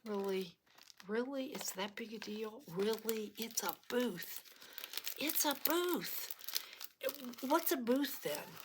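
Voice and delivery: high, bewildered muttering; incredulous inner voice. Retold or imitated. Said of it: "sitting there going".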